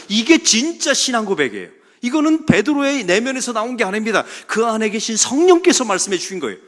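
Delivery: loud, high preaching that drives each point home; fiery preaching. Acted. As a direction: preach it to the back pews. Preaches at full force.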